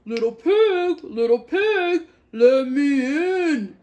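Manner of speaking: loud, slow menacing wolf call; wolf calling at the straw house, loud and slow; big bad wolf. A narrator's reading. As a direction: boom it out like a hungry wolf. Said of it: "cried the wolf".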